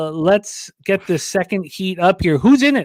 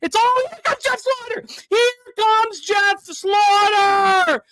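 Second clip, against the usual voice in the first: horse race call; 8 semitones higher than usual. Pretend. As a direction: build the race to a frenzied finish.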